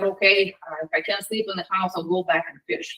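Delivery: low, quiet resignation; subdued, hurried grudging agreement; frustrated, upset. Acted. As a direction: give in wearily, dropping the voice. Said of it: "got really frustrated and upset".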